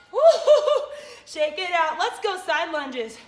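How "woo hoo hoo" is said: loud joyful woo-hoo; high whooping cheer. Real, not acted. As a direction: whoop with a laugh at the finish. End of burnout cheer.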